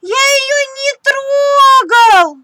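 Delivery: high, loud, very slow sobbing protest; crying. Acted. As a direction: sob out the denial. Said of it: "плачет Тим". (сквозь слёзы, протяжно)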